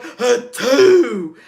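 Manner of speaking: huge explosive sneeze, very loud; man sneeze. Shown as a demonstration. Showing the sneeze when allergies are revved up, man-sized blast. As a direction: demo a full-force allergy sneeze. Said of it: "then there's the man one".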